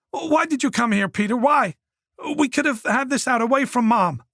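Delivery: put-on a cornered young man begging; Oliver pleading. A narrator's reading.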